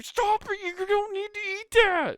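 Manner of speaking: put-on very high, slow, whining child voice; whiny kid. Kid at Christmas dinner begging the uncle, high whine. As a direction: whine like a starving kid waiting for presents.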